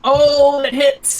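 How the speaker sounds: high, loud burst of excitement; excited exclamation. Real, not acted. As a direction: call out the hit, thrilled. Game master reacts to successful roll.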